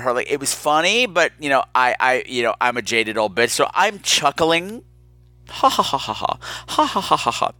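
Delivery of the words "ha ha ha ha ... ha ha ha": measured, spoken "ha ha" chuckle; dry chuckle. Shown as a demonstration. Woman shows how little she was laughing, dry chuckle. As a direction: demonstrate a jaded, restrained chuckle. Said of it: "Like that".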